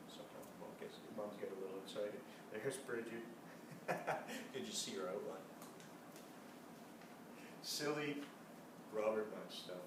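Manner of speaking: soft, low commentary; hushed aside. Real, not acted. Reader quietly commenting to the children on the silly mom.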